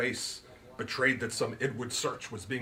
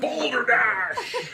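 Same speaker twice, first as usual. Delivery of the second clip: put-on higher, louder, grand old gentleman; Root's voice; 13 semitones higher, 7 dB louder, much slower than usual. A narrator's reading. Root lectures his guest on the magic word, higher and grand. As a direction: pronounce it like a showman, slowing on the magic word.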